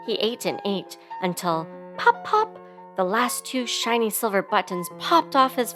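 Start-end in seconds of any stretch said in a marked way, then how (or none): 2.0-2.6 two high pops; popping sound words. A narrator's reading. Narrator tells of last buttons bursting, two high pops. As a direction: make each word burst out high.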